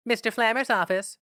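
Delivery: high secretary voice answering a call; secretary on the phone. A narrator's reading. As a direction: answer like a chirpy office secretary.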